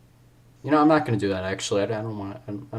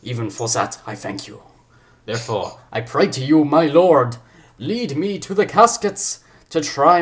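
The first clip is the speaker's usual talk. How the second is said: slow, swooping put-on accent; stretched-out, exaggerated theatrical accent; the Ryan accent; 4 semitones higher, 6 dB louder, slower than usual. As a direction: declaim grandly in a hammed-up accent. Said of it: "gonna go for the Ryan accent".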